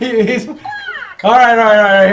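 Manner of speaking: exasperated. Real, not acted. Calming the noisy toy.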